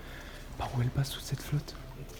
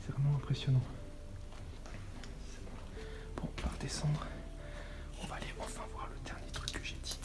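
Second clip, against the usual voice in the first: sneaking through a warehouse whisper; hushed, careful exploring voice; 8 dB quieter than usual. Real, not acted.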